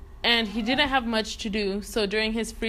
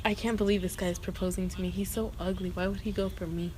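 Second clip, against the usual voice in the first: quick, softer private grumble; incredulous aside; 3 semitones lower, 6 dB quieter, faster than usual. Acted. Woman puzzled by Rasputin's proposal, incredulous aside. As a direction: say it quietly, as if to oneself.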